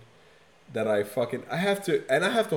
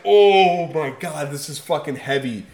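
awed exclamation; 3 semitones higher, 9 dB louder than usual. Retold or imitated. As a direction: drag out the amazement. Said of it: "I'm just like".

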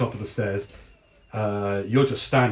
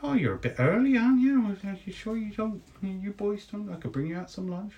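fussing Deli; 9 semitones higher than usual. Acted. Deli offering the early guards lunch, higher and fussy. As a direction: offer lunch like a doting landlady.

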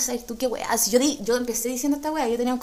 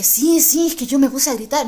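louder, keen to tell her story; eager excited answer; 2 semitones higher, 8 dB louder than usual. Retold or imitated. Girl answering the witness in the bus, louder and eager. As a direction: jump in eager to explain.

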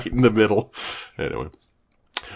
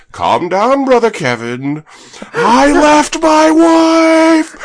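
loud, much higher, very slow crying yell; wailing shout; 11 dB louder, much slower than usual. Retold or imitated. Sketch guy answering Brother Kevin, a slow crying wail. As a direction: howl it in over-the-top despair. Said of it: "He goes".

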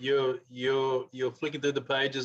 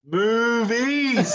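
higher, louder punchline; corny dad laugh; 9 semitones higher, 5 dB louder than usual. Acted. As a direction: blurt the punchline, much higher. Punchline called out.

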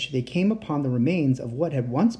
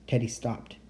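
ordinary delivery, nothing marked

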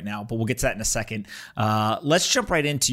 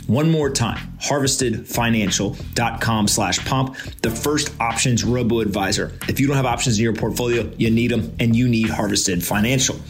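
punchy, louder podcast sponsor read; 5 dB louder than usual. Acted. Host reads sponsor ad.

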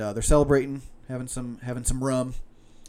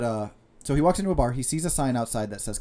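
ordinary talk, nothing marked

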